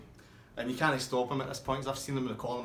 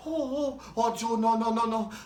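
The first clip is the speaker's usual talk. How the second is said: high, louder urgent no no no; the woman begging Joe to stop, high and frantic; panicked pleading; 10 semitones higher, 6 dB louder than usual. Retold or imitated.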